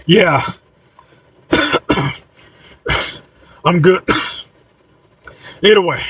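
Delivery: acted reply broken up by coughs; recovering from smoke, words between coughs; coughing. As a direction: answer haltingly between coughs. Coughing from smoke after rescue.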